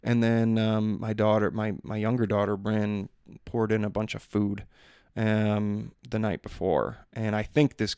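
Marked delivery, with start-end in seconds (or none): none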